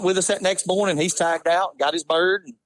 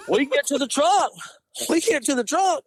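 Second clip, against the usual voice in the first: laughing through the words; chuckling as he tells it; amused, laughing retelling; 9 semitones higher than usual. Real, not acted. Laughing while retelling mishap.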